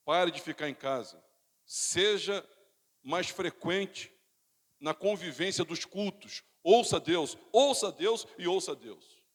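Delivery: insistent pulpit exhortation, building in volume; forceful exhortation. Acted. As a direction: urge the congregation in slow, loud phrases. Preaching exhortation.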